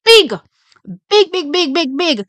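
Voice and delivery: rising chant of big; hyped. Real, not acted. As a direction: pump it up. Repeated hype words.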